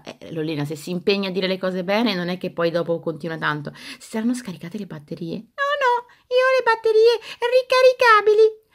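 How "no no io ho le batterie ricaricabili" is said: high, chirpy toy doll voice; squeaky doll voice. Acted. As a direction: play a cheeky little doll.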